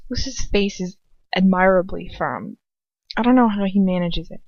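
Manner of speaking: ordinary delivery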